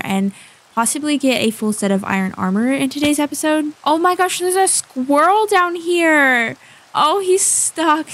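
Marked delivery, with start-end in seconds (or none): 3.8-8.1 high, startled and delighted; sudden high surprise; surprised. Real, not acted. Finds stuck squirrel in cave.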